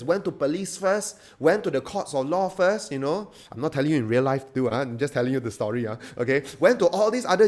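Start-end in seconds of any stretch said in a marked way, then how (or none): none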